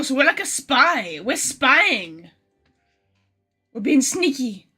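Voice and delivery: ordinary talk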